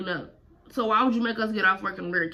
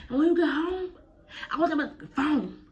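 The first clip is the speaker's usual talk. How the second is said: high, slow mock mom demand; mama demanding the phone, high and slow; imitating mama; 7 semitones higher than usual. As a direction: draw it out like a mother laying down the law. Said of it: "she like".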